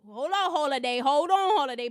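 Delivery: calling out. Real, not acted. Calls to her producer.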